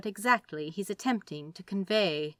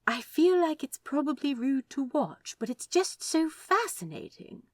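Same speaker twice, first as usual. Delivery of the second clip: Aziraphale; 8 semitones higher than usual. Acted. Aziraphale voiced, higher.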